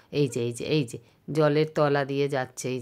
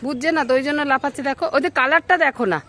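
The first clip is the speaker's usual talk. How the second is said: excited chatter; 8 semitones higher, 6 dB louder than usual. Real, not acted. Excited at the monkeys.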